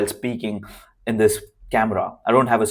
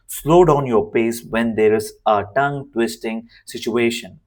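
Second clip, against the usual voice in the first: ordinary talk, nothing marked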